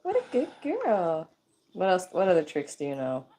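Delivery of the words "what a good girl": much higher, slow sweet praise; praising the bird. Real, not acted.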